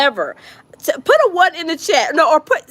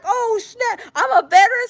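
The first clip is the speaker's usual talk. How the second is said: mock whining; 6 semitones higher than usual. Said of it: "He was like".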